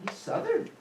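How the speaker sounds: mock quote. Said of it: "and he's like".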